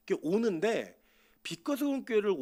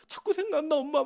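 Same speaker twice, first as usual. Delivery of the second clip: high-pitched mock crying to mother; imitated tearful whine; 7 semitones higher than usual. Daughter telling mother she misses the man, mock tearful. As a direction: plead like a lovesick child, lightly acted. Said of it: "막 이러면서 울고".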